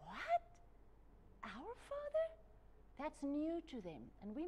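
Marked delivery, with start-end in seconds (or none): 0.0-2.3 high incredulous rising what, our father; shocked disbelief, pitched way up; astonished crowd. Retold or imitated. Crowd hearing Jesus say our Father, astonished. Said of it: "the crowd's like".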